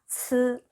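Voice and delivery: "츠" held out on a level tone; pronunciation demo. Shown as a demonstration. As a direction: model "츠" as one long, even syllable. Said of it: "츠 이렇게 읽으시면 됩니다". ("츠"를 평평하게 길게 읽는 발음 시범)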